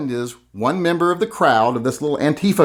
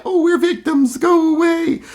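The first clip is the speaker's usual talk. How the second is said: very high whiny mockery; mock victim whine. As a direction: play the self-pitying victim. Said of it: "now it's like".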